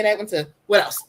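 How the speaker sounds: ordinary talk, nothing marked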